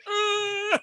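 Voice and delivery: high, loud pained cry; pained yell. Retold or imitated.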